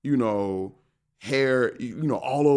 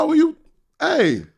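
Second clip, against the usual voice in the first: flustered yell; 9 dB louder than usual. Retold or imitated. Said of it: "I'm like".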